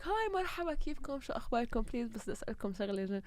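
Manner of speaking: high, soft, overly polite greeting; sweet high greeting voice. Retold or imitated. Retold greeting people on street.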